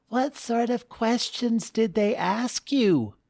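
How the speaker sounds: put-on high, light child voice; young girl voice. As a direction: voice the curious daughter. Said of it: "asked Faye".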